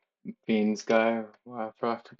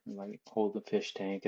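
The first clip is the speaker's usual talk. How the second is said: ordinary talk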